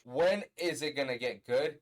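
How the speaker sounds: loud exasperated listener's complaint. Acted. Reviewer acting out his reaction to a boring album, exasperated. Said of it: "listening to the album just like this".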